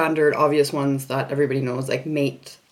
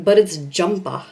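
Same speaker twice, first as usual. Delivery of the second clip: put-on British accent; slower than usual. Insisting on British word to sisters.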